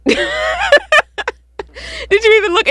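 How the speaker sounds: playfully appalled, voice shooting high; mock scolding. Repeats mock scolding after laughing.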